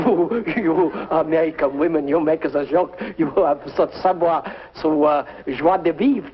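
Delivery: put-on hammy French charmer accent; fake French accent. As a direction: flirt in a corny French accent. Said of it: "a very bad French accent".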